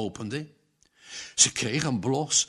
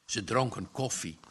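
ordinary delivery, nothing marked

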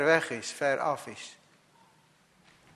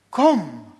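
a louder call of Kom; voicing the Lord; 10 dB louder than usual. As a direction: call out as God calling. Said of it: "zegt de Heer". (roepend als de Heer)

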